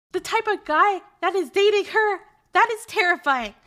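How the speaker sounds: put-on can't believe she has a boyfriend; shocked. Narrator shocked about boyfriend.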